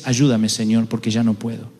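low, soft, worn-out prayer; a quiet, exhausted plea to God; weary plea. Retold or imitated. Preacher voicing a tired believer praying, low and soft.

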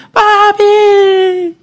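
little daughter at 1 a.m., scared and crying out high; frightened little girl. Retold or imitated. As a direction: cry out for daddy like a scared child. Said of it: "mi hija estaba llorando".

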